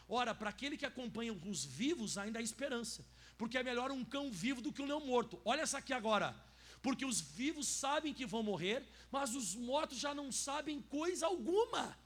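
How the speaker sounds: loud, emphatic Bible reading; scripture reading. Acted. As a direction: proclaim the verse to the church. Reads scripture at full force. (leitura bíblica forte e enfática)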